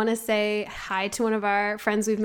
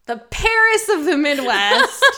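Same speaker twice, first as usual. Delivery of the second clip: laughing mock grandeur; 5 semitones higher, 5 dB louder than usual. Shown as a demonstration. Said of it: "I mean the Paris".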